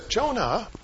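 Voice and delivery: acted tender rebuke, lifting into questions; gentle reproof. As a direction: reason softly with someone upset. God gently reproves Jonah.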